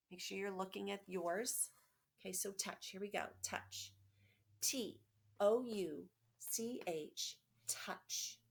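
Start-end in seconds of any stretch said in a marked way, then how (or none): 3.4-8.4 slow letter-by-letter spelling, each letter apart; tapping out a word. Shown as a demonstration. Teacher taps out touch for young students, slow and spaced. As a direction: spell it out slowly for kids tapping along. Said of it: "let's tap that out".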